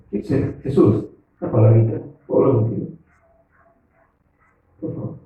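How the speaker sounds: Peter gently asking Jesus for a word in private; pleading Peter. Shown as a demonstration. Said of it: "No, él va a decir".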